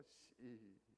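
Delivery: low, soft curt grunt; one terse grunt instead of a greeting; gruff Gyeongsang grunt. Shown as a demonstration. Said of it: "경상도 버전이 있긴 한데". (무뚝뚝한 경상도식 한마디)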